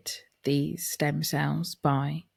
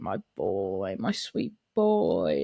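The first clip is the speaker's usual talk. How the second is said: tender; 8 semitones lower, slower than usual. Retold or imitated. Auntie cupping nephew's little face, slow and tender. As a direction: melt over the little boy.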